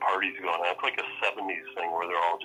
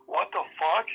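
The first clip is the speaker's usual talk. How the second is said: shocked friend; 10 semitones higher than usual. Retold or imitated. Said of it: "my son's friend goes".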